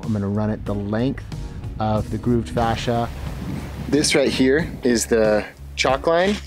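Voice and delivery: normal talking voice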